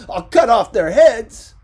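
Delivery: very loud, high, angry; furious threat. Acted. Ricardo threatening the photographers to his wife, furious. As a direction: hurl the threat on the way out.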